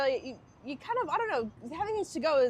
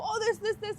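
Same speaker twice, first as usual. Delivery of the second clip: mock excited pointing; 11 semitones higher than usual. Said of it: "they go".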